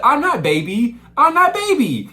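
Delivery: put-on very high, loud baby-talk whine; mock baby talk. Spoiled boy repeating himself, high squeaky baby talk. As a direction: squeak it like a toddler throwing a fit. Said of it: "why are you talking like that?".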